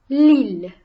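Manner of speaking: "L'île" with a loud, prominent î; stressed circumflex vowel. Shown as a demonstration. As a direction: punch out the î in "L'île". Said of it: "makes the vowel sound more stressed".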